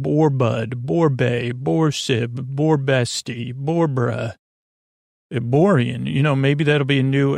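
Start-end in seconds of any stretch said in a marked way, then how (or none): none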